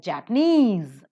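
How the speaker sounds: Japanese with a long, loud -nese; Japanese, slow, weight on -nese; stress on last syllable. Shown as a demonstration. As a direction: stretch and punch the end of Japanese. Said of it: "na is stressed".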